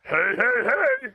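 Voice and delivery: loud cartoon catchphrase, climbing high; Fat Albert. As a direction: bellow it, rising on each hey. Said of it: "I can do Fat Albert".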